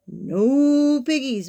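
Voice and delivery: long, drawn-out mooed "no"; very slow cow voice; mooing cow. A narrator's reading. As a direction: moo the words slowly. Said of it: "mooed the cow".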